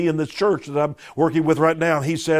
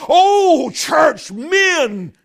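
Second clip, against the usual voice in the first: much higher and louder, a full cry; a shouted appeal to the men of the church; preaching shout; 13 semitones higher, 7 dB louder, much slower than usual. Real, not acted. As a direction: call out to the church at full force. Preacher's climactic exhortation.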